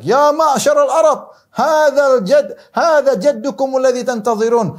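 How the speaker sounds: high, drawn-out proclamation in Arabic; imitated shout. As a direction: call out to a whole crowd, loud and stretched. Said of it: "Maka dia teriak". (menirukan teriakan lantang bernada tinggi)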